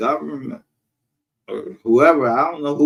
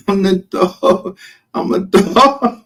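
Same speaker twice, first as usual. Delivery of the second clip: slow boast; 7 semitones higher, 4 dB louder, slower than usual. Real, not acted.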